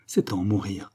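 usual speaking voice

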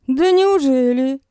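high, slow, sarcastic retort; snapping back. Acted. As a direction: snap back with mock surprise. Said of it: "огрызнулась я".

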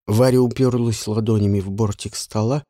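ordinary delivery, nothing marked

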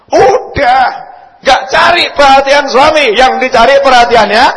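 loud, high exhortation; preaching shout. Real, not acted. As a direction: drive the point home loudly.

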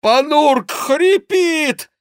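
high, very slow alarmed shout; monk's shout. A narrator's reading. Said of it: "кричал Гранфло". (протяжный тревожный крик монаха)

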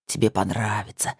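low, softened male voice; insinuating ex-husband. A narrator's reading. Ex coaxing the woman he pins, low and insinuating. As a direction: coax her low and close. Ex coaxes while pinning her.

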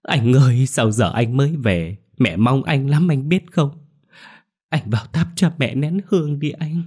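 high, loud wailing sob. Acted. Wife greeting her husband home, wailing loud and high. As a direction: throw herself into a showy lament. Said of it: "gào khóc thảm thiết".

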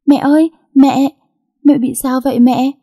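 put-on high, loud panicked child's call; crying out loud. A narrator's reading. Girl finds mother collapsed, loud high panicked cry. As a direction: shout for mother in alarm. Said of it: "nó liền kêu to".

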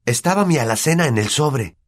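put-on high-pitched boy's argument; boy angrily correcting his uncle; angry. A narrator's reading. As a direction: argue back crossly with proof. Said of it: "dijo Harry enfadado".

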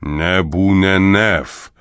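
slow, hollow oracle voice; sepulchral voice. Acted. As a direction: speak like a voice from the grave. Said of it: "разнёсся замогильный голос".

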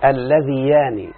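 اللذيان said slowly, syllable by syllable, as the form not to use; slow wrong form. Shown as a demonstration. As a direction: drag out اللذيان as the mistake. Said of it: "لا تقل". (نطق اللذيان ببطء كخطأ)

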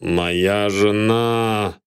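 very slow, drawn-out groan; long anguished moan of words. A narrator's reading. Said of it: "простонал сэр Руперт".

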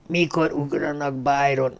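faint, hushed question from a dying man; weak whisper. A narrator's reading. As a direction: ask it softly, barely able to speak. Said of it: "de csak suttogni tudott".

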